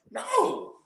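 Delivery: high mock-alarmed no; high squeaky no. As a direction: yelp a quick no. Flat refusal.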